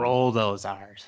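R's with a long trilled R; rolled r. Shown as a demonstration. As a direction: roll the R in R's slowly. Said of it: "That's a nice roll of the R there".

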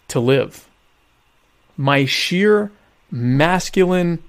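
dragged out, each word weighted; slow grandiose proclamation. Acted. Host boasting to his listeners, slow and grandiose. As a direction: savour every word like a hero's speech. Mock heroic self-praise.

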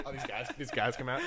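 ordinary talk, nothing marked

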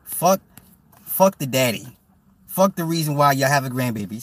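mock dismissive relatives. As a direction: imitate family brushing off the dad. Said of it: "they just be like".

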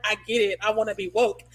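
imitating a clueless woke student. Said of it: "you're like".

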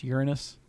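"Uranus" said as YOOR-nus; corrected pronunciation. Shown as a demonstration. Co-host restates the correction, "Uranus" as YOOR-nus. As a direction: softly insist on the Y in "Uranus". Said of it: "Isn't it pronounced Uranus".

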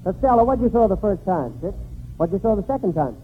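normal talking voice

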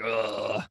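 low, soft groan of "ugh"; mock fed-up, low groan; weary groan. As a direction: groan as if dreading the day. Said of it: "most of the time we're kinda like".

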